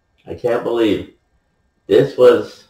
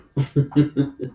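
soft chuckle; 4 semitones higher, 6 dB quieter than usual. Real, not acted. Reporter marvels at the receiver's game, chuckling softly. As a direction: chuckle softly in short, even pulses.

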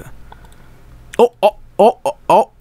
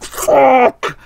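mock pained curse; 8 semitones higher than usual. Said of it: "he's like".

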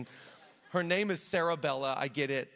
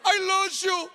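high, loud, panicked backpedaling; frantic shouted apology; 9 dB louder, much slower than usual. Retold or imitated. Caught teen yells his apology to his dad, high-pitched and panicked. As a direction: squeal it out like a caught kid begging forgiveness. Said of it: "I was like".

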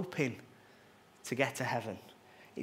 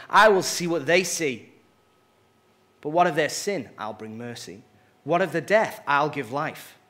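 louder, firm royal declaration; resolute king; 7 dB louder than usual. Acted. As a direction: proclaim each vow with authority. God answers Satan with resolve.

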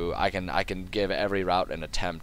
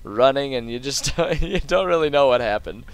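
laughing; 6 semitones higher, 5 dB louder than usual. Real, not acted. Joking about being gumped, laughing.

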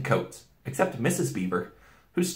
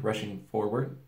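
ordinary delivery

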